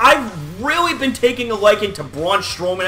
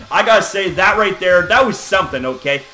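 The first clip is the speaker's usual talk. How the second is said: ordinary talk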